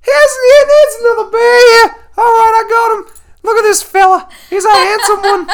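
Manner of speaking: very high falsetto Aussie wildlife host; loud mock-female voice presenting a catch; girly voice. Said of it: "I have more of a girly voice".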